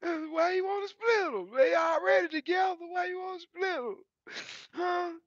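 very high, crying falsetto whine; mock whiny officer. Driver mocks scale officer in a very high crying whine. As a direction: whine it like a squeaky cartoon boss. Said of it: "He said".

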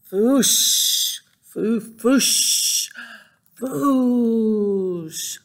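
playful dragon fire noises; fire whoosh sounds. Acted. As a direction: puff out dragon fire again and again. Dragon breathes fire repeatedly.

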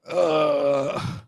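long, level "uh" of reluctance; drawn-out groan. Shown as a demonstration. Preacher showing dread of a difficult verse, drawn-out groan. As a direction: groan as if facing a hard verse.

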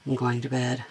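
low, quiet, accidental growl of a tired voice; tired words dropping into an unintended demon rumble. Real, not acted. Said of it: "that sounded like demon voice".

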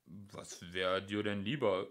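ordinary delivery

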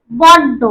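বড্ড with a doubled ড; stressed double d. Shown as a demonstration. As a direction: hit the ড in বড্ড hard. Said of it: "ডয়ের উপর শাসাঘাত দিয়েছি".

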